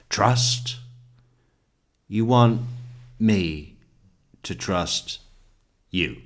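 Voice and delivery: TRUST and WANT punched out, word by word; Erin Brockovich line. Retold or imitated. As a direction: break it up with incredulous pauses, hitting TRUST and WANT. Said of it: "like the, uh, Aaron Brockovich line".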